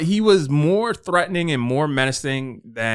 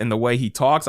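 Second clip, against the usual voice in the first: ordinary talk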